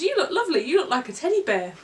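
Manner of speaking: a hint of a sweet child's voice; kind little girl. Little girl compliments the old man, lightly voiced child. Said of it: "And she says".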